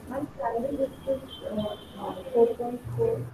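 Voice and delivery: faint, far from the mic; very soft, hard to hear; barely audible. Real, not acted. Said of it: "sorry, your voice is very low".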